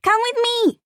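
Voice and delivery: put-on very high, loud girl's offer; eager little girl. A narrator's reading. Small girl offering her ball to the boy, very high and eager.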